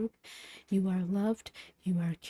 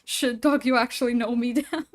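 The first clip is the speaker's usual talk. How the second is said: laughing; 4 semitones higher, 8 dB louder than usual. Real, not acted. Laughing after touching message.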